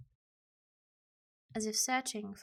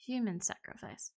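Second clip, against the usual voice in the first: normal talking voice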